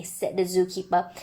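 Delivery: plain reading voice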